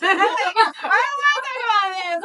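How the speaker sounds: high, slow, laughing, whiny quote of her fed-up self; hammed-up, exasperated groan; laughing mock whine.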